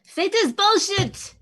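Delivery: loud, high, slow protest; frustrated shout at the unfairness; indignant outburst. Acted. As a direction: cry out against it, stretching each word. Outburst after friend accepts sacrifice.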